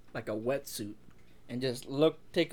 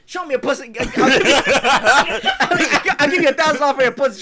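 loud, high, excited mock yell; 8 semitones higher, 9 dB louder, faster than usual. Quoting a rapper on Instagram Live, high mock yell. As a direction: imitate a rapper yelling out a wild offer.

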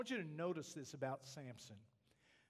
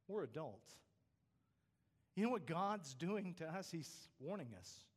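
usual speaking voice

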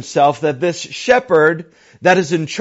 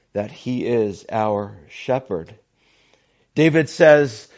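usual speaking voice

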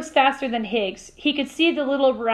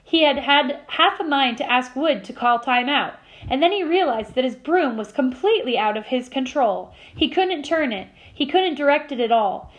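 ordinary delivery, nothing marked

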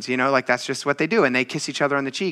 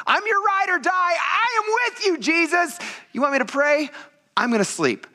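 very high, hammed-up boast that eases down; mock Peter. Preacher mocking Peter's loyalty to Jesus, high hammed-up boast. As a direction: play an over-keen disciple in a squeaky high voice.

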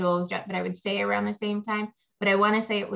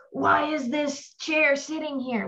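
mock anxious fretting; 8 semitones higher, slower than usual. Retelling anxious thoughts on rough nights.

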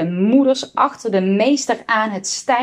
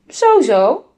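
louder, higher indignant teacher; raised-voice disapproval; schoolmaster's exclamation; 5 semitones higher, 5 dB louder than usual. A narrator's reading. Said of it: "riep de meester".

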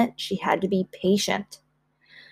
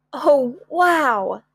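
high, wowed exclamation; amazed; 5 semitones higher than usual. Acted. Child seeing the tent in the yard, amazed. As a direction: gasp it out in wonder.

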